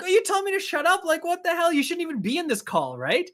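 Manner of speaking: high-pitched, indignant mock comeback; heated retort. Player retorting to the other coach in the call, heated. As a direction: act out an offended player's heated reply. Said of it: "that was the most heated it got".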